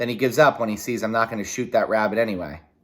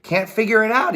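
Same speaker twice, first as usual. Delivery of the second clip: puzzled Dad; 11 semitones higher than usual. Acted. Dad puzzled, voiced higher.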